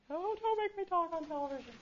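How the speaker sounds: high mock plea of a camera-shy student; a student begging not to be recorded, high and whiny. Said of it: "You're like".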